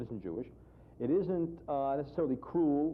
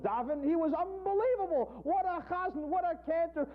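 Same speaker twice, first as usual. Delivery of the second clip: awed rapture. Acted. Rapturous praise of the dog.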